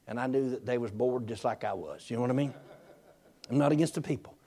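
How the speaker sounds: hushed aside to the crowd; quiet aside. Real, not acted. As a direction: drop the voice to share a secret. Confiding aside to congregation.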